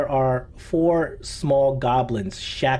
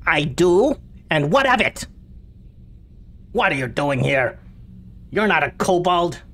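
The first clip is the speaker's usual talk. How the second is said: higher, defiant goblin voice; 5 semitones higher than usual. Acted. Goblin prisoner answers the adventurer, higher and defiant. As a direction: snarl back at the stranger. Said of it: "The goblin says".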